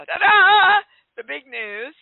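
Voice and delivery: high, loud fanfare ta-da; excited ta-da. Real, not acted. Host unveiling the big news to listeners, excited ta-da. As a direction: trumpet the reveal like a drumroll payoff.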